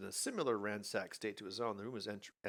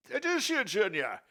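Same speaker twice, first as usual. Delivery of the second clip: put-on old father voice; 11 semitones higher, 7 dB louder than usual.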